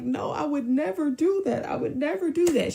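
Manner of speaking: high, whiny mock protest; hammed-up wounded denial. His retold denial to mom.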